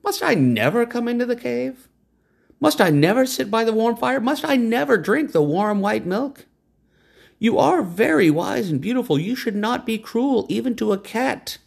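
high, falsely pleading cat; a hint of put-on pity; mock sorry cat. A narrator's reading. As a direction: beg with fake sorrow. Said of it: "Cat pretended to be sorry".

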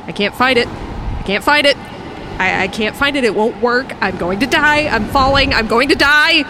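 frantic, building terror; mounting panic. Acted. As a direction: spiral into panic as the ripcord fails. Ripcord fails while falling.

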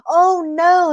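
high-pitched fake sympathy; mock dismay. Rabbit hears coyote is being hit, mock dismay. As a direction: gasp it in pretend horror.

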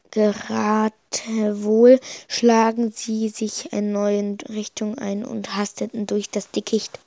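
plain reading voice

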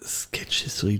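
whispered to himself; breathy aside, no voice; whispering. Real, not acted. Noting name quietly.

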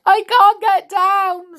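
high, loud, slow wailing cry; sobbing. A narrator's reading. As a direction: sob it out despairing. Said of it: "sobbed Amelia".

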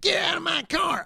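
very high, strained mock shout; crazy voice. Hillbilly ordering a man from his car, crazy high shout. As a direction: squeal the order in a wild voice. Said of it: "his voice is crazy".

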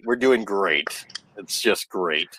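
slow deadpan. Shown as a demonstration. Repeating the line to friends, slow and low. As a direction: let it drop flat and drawn out.

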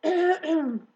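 loud, deliberate throat clear; big attention-getting ahem. A narrator's reading. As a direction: clear your throat pointedly before an announcement. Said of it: "Wilbur cleared his throat".

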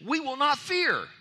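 high, loud and slow proclamation; loud declaration. Real, not acted. Preacher declaring to the church, loud and slow. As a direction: proclaim it to the congregation.

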